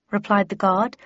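usual speaking voice

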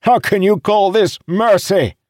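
high, loud, outraged question; anguished wail. A narrator's reading. Restrained captain challenging the commander, wailing. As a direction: cry out in disbelief. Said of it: "Idara wailed".

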